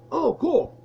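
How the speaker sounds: light up at the prank idea; delighted. Retold or imitated. Friend hearing the fake-fight plan, delighted.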